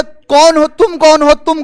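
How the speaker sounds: loud, high-pitched mock interrogation; imitated stern challenge. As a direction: demand it loudly, like an officer challenging a candidate. Acts examiner challenging the candidate. (ऊँची आवाज़ में अफ़सर की डाँट की नकल)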